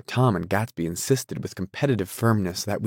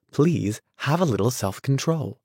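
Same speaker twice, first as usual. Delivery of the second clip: higher-pitched, mildly pleading reproach; a touch of desperate appeal; exasperated plea; 5 semitones higher than usual. Acted. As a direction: plead for some restraint. Daisy pleads during a quarrel.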